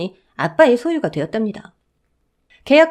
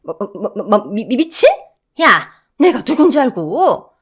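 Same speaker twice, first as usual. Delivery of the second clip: stammering, then a much higher, louder scream; mother-in-law screaming she owns the building; screaming rage; 7 semitones higher, 6 dB louder than usual. Acted. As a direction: sputter then scream at him. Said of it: "소리를 고래고래 질러서".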